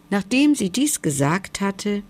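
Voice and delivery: ordinary delivery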